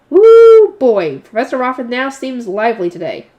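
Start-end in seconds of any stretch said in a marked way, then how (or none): 0.1-0.7 high, loud "woo"; real whoop, far louder than talk; excited whoop. Real, not acted. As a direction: let out a big, high-pitched whoop. Reacts to the lively professor.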